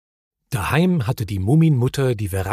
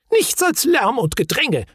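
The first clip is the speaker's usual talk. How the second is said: high, shrill-pitched fussy shriek; fussy Gafsa voice pitched very high; Gafsa's shriek; 12 semitones higher than usual. A narrator's reading. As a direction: screech it like a flustered old lady. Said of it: "schrie die Gafsa".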